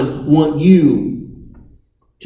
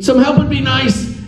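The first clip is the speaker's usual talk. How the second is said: light, higher pitched, no urgency in "some help would be nice"; casual understatement; 10 semitones higher than usual. Shown as a demonstration. Preacher contrasting a casual request with a real cry, offhand. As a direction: say it like a shrug, nothing's wrong. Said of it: "There is a world of difference between".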